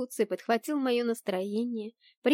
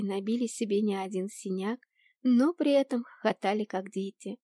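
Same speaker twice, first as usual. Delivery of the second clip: ordinary delivery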